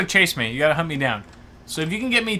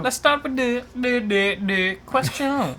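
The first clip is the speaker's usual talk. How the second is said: halting mock Vietnamese accent; 2 semitones higher, slower than usual. Co-host opening questions as punishment, Vietnamese accent. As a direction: stumble along in a put-on Vietnamese accent. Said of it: "I will do a Vietnamese accent".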